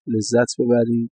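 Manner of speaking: ordinary talk, nothing marked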